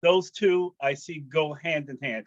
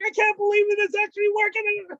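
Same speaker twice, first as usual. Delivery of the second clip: much higher, giddy with joy; inner joyful scream; 3 dB louder than usual. Retold or imitated. Inner voice when the sister reconciles with mom, giddy high excitement. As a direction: let the secret delight burst out. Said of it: "But inside I'm screaming".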